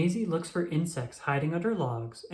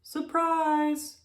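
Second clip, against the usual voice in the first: high, drawn-out surprise call; spring the surprise on friends; excited surprise; 12 semitones higher than usual. Acted. Tallulah revealing flower crowns to her friends, excited.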